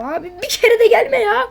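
high, loud fed-up cry; exasperated yell. Real, not acted. Player yelling at the game, fed up. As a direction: let it all out at the game.

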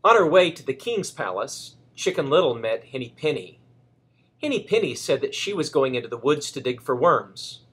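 gentle, lively storybook reading; reading to a child. A narrator's reading. Grandparent reading Chicken Little to Reese, lively storybook voice. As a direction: read it aloud for a little grandchild.

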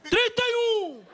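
very high, stretched auction yell; acted long, loud bid; drawn-out bid shout. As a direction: holler the number slowly to the room. Shouted bid, then stunned repeat.

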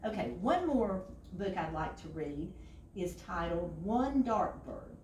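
plain reading voice

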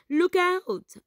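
high, loud urgent warning shout. A narrator's reading. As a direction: yell it to warn the fishermen. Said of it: "Milo warned".

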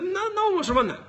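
high, exasperated complaint; exasperated protest. Real, not acted. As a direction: let the voice leap up in protest. Exasperated at media ignoring him.

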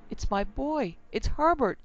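rising to a high, loud cry on the name; a mother calling out in frantic hope; crying out for her boy; desperate cry. A narrator's reading. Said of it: "she cried".

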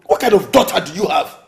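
heated, exasperated rebuke; angry scolding. Acted. Quarrel over their daughter.

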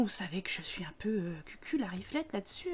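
hushed confiding aside; confiding whisper. Real, not acted. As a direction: whisper it like a secret. (murmure complice chuchoté)

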